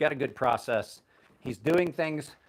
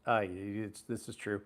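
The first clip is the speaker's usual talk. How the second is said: normal talking voice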